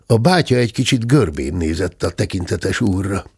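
usual speaking voice

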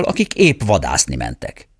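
usual speaking voice

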